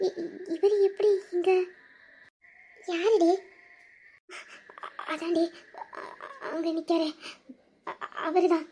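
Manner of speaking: hushed voices at a patient's bedside. Acted. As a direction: keep it low so you don't wake anyone. Hushed talk by hospital bed.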